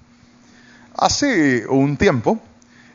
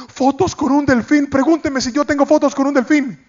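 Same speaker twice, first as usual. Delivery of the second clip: high, delighted excitement; eager pastor; 9 semitones higher than usual. Retold or imitated. Pastor excited about dolphin photos, high delighted voice.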